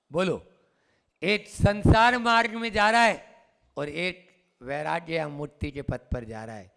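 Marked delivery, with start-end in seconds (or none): none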